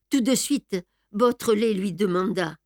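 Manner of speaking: ordinary delivery, nothing marked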